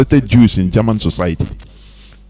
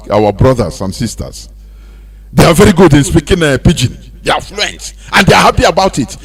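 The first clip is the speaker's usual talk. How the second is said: normal talking voice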